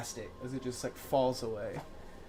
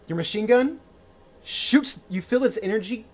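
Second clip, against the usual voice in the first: dramatic scene narration; 4 semitones higher, 3 dB louder than usual. A narrator's reading. GM describes magic effect unfolding.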